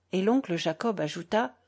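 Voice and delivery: ordinary delivery, nothing marked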